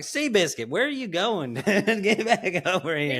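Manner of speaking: mock exasperated holler; calling the horse. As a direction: call after a wandering horse.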